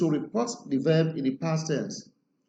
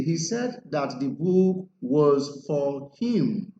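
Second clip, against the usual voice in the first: 1 slow modelled sentence; much slower than usual. Shown as a demonstration. Teacher models reported sentence.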